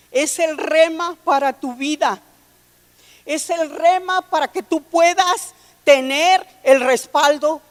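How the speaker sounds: sermón proclamado con fuerza, más alto; predicación enérgica. Acted. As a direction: predicar con fervor y volumen. (voz de predicador que se eleva y proclama)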